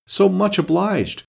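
a prim little bow of a line, drawn out high; mock-courteous twin, high and slow; polite Tweedledee. A narrator's reading.

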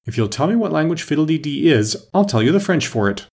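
high, mildly triumphant offer; spring the clever way out with some triumph. A narrator's reading. Alice outwitting the Red Queen, mildly triumphant. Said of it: "she exclaimed triumphantly".

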